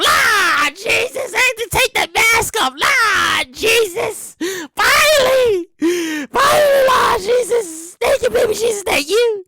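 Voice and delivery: high, drawn-out mock-wailing praise; hammed-up joyful relief. Mask wearer finally freed, hammed-up wailing relief. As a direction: cry out thanks like a churchgoer overcome.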